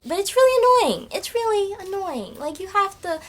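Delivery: exasperated, voice shooting up high; real exasperation. Real, not acted. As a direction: let the annoyance burst out high.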